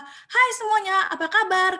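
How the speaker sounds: loud, high, drawn-out hello to the crowd; lively audience greeting. Shown as a demonstration. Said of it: "interaksi ini bisa berupa".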